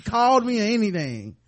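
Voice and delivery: loud, high, indignant complaint; mock outraged queen. Dany furious to her council, loud indignant mock rant. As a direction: rant like a boss home to a wrecked house. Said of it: "She's like".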